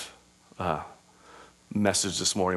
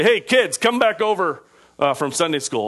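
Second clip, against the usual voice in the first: high, louder call across the room; calling kids over; 7 semitones higher, 6 dB louder than usual. Pretend. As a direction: call the children back cheerfully. Calling children back from Sunday school.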